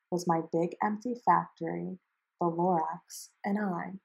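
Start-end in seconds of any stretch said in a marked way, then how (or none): none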